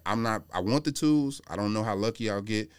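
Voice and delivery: ordinary delivery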